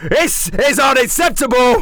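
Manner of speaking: high, loud furious yell. Acted. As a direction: explode at the guests. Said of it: "And he yells".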